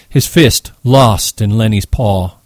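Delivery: usual speaking voice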